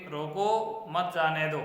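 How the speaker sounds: normal talking voice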